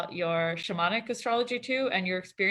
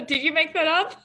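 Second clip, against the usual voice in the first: laughing; 9 semitones higher, 5 dB louder than usual. Real, not acted. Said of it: "I love that word".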